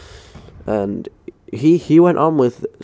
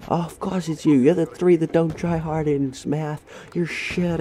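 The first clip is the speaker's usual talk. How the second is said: high-pitched imitation of a ranting teacher; mock ballistic teacher; 7 semitones higher, 3 dB quieter than usual. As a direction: hurl accusations as the teacher in a put-on high voice. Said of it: "So he was going ballistic".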